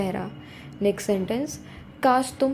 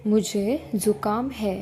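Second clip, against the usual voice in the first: ordinary talk, nothing marked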